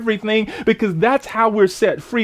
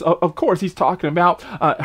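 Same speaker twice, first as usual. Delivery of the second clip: ordinary delivery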